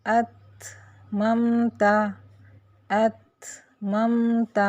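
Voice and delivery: اتممت very slow, the final ت stopped then released with a puff; aspirated t recitation. Shown as a demonstration. Teacher reciting اتممت slowly as an example. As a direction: hold اتممت and breathe out the ت. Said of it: "اسی طرح تا میں".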